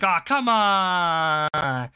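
stretched, high protesting whine; mock schoolkid complaint, drawn out; whining kids. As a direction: groan like a kid losing recess. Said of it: "it'd be like".

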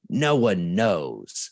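slow smug. Retold or imitated. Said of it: "And I'm like".

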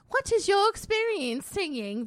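high, loud, slow bad British accent; hammed-up British talent-show judge; Sharon. Sharon questioning a contestant, in a badly faked British accent. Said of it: "my bad British accent".

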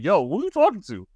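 high, indignant challenge; retold instant retort; snap reaction. To supervisor who yelled, instant indignant comeback. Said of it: "it was just a counter reaction".

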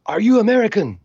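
high, loud soldier's shout; yelling soldier. Retold or imitated. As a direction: bark the question at the door. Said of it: "they're yelling".

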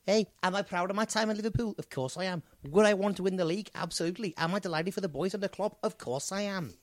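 eager put-on Liverpudlian interview patter; Scouse footballer. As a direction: footballer answering his own questions in Scouse. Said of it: "in a Scouse accent".

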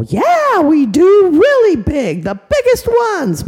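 put-on loud, high shouting kids; excited kids. Kids yelling to Mommy for really big pumpkins, loud and high. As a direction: shout it like eager children. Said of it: "They go".

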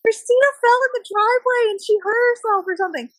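very high mock-panicked report. Friend reporting the fake injury to mom, panic voice. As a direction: blurt it out high, imitating panic. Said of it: "like, panic voice".

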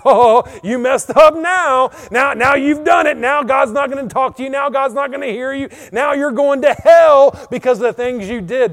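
high, loud, gloating accusation; loud, condemning tempter; accusing Satan. Acted. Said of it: "that's the Satan talking to you".

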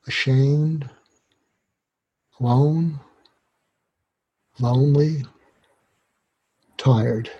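slow, measured public-radio calm; inviting. Shown as a demonstration. Inviting a troubled teenager to pick a word, slow and inviting. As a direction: offer each word slowly, with long pauses between. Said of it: "you say it in an inviting way".

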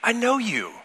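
high, intimate voice of recognition; tender calling. Pretend. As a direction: call her name like someone who knows her. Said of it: "in a way that communicates".

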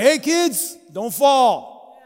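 put-on alarmed parent shouting up at the kids; parent calling out. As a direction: yell up to the kids like a worried dad. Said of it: "I'm like".

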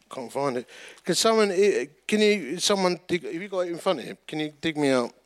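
ordinary delivery

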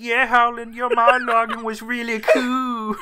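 high silly ventriloquist voice for the dead man; corpse puppet voice. Acted. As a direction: work his mouth like a puppet, high-pitched. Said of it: "he's like".

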